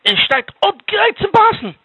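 high, loud storyteller's alarm; alarmed suspense. A narrator's reading. Storyteller warns of the snake ready to strike, high alarm. Snake poised to bite the sleeper.

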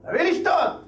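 high, pushy demand for a drink; drunk demand. Acted. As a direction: bark it at the wife like a drunk.

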